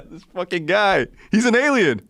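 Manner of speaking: high, laughing excited outburst; amused disbelief. Real, not acted. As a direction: crack up as you call him an alien. Laughing at the village man.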